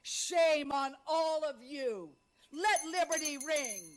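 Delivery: ordinary talk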